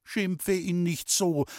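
high, slow, pleading reproof; protesting mother. A narrator's reading. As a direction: plead for the boy as his mother. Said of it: "hat meine Mutter gesagt". (hohe, bittende Mutterstimme)

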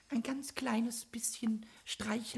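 soft breathy whisper, slowed; pleading whisper. Acted. Goblin whispering a timid plea to the carpenter. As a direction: ask as if afraid to be refused.

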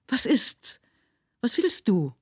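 high, jolted questions; startled outcry. A narrator's reading. Mother roused from her daze by her son, startled. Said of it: "rief sie auffahrend".

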